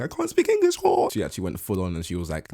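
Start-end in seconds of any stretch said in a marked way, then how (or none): 0.0-1.1 much higher, louder giddy squeal; mock giddy girl. Mocking the contestant's usual giddy girl act, high squeal.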